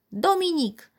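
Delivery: high-pitched grandmotherly call; calling from another room. A narrator's reading. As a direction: call out to a boy in the next room. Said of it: "zawołała z dużego pokoju".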